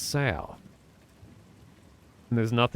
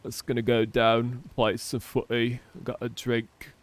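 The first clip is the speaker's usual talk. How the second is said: ein Hauch britischer Kumpelton, locker dahingesagt; lightly imitating a British lad's accent, casual. Mocking British slang speech.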